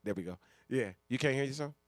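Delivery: normal talking voice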